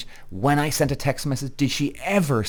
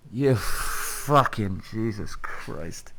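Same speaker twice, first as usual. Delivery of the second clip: exasperated groan-swear; much slower than usual. Real, not acted. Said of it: "Okay, I'm good".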